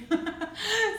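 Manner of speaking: high breathy giggle; giddy laugh. Real, not acted. Laughing with joy over the candle, high giggle. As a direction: let out a delighted giggle.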